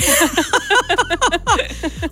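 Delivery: long, high fit of laughing; long laughter. Real, not acted. Retelling the doctor mix-up to a friend, laughing helplessly. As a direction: let the laugh roll on, helpless with amusement.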